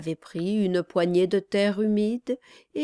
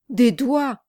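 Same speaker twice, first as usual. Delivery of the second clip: loud, raised outcry; cried out; 5 semitones higher, 7 dB louder than usual. A narrator's reading. Charlot showing his companion the bloody marks, crying out. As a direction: cry it out in alarm, higher and louder. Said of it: "cria Charlot".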